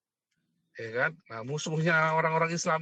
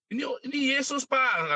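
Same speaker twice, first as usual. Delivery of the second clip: much higher, meek mock plea; high pleading put-on voice; pleading citizen; 11 semitones higher than usual. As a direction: imitate a timid man protesting. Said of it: "katanya si orang Cina".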